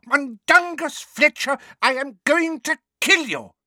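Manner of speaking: high, seething threat; furious threat. Acted. Neighbour threatening the man who left his post, furious. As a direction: draw out the name in high-pitched fury. Mrs Figg threatens Mundungus.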